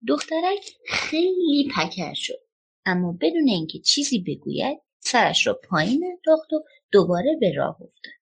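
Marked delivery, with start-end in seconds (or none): none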